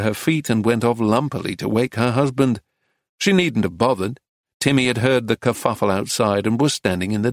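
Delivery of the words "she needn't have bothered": plain reading voice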